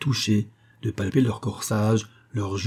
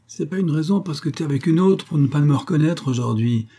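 higher-pitched scolding, moderately raised; narrated yell, only partly raised; indignant shouting; 6 semitones higher, 3 dB louder than usual. A narrator's reading. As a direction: keep railing in complaint. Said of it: "Elle aurait crié longtemps".

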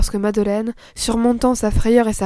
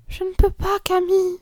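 faint trembling voice; 9 semitones higher than usual. A narrator's reading. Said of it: "d'une voix faible et tremblante".

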